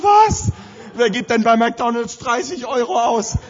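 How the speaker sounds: a sudden high, loud "Was?", then an outraged question; half-joking outrage at a thirty-euro breakfast; shocked disbelief. Retold or imitated. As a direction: gasp at the bill in mock horror. Shocked by the price.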